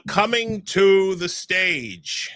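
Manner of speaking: normal talking voice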